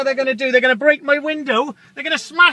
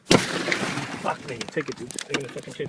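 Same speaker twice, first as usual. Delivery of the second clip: whispered curse; 13 semitones lower, 11 dB quieter, slower than usual. Real, not acted. Driver curses under breath.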